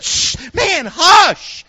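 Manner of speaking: high, loud hushing rebuke; shush, then come down hard on the last word; scolding disciples. Retold or imitated. Disciples telling a blind beggar to hush, loud scolding. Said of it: "disciples come over and said".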